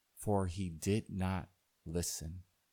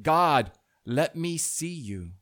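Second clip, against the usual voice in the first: loud, raised-pitch plea to God; calling out; 6 semitones higher, 10 dB louder than usual. A narrator's reading. Man looks around for God, calling out loudly. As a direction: cry it out to the heavens.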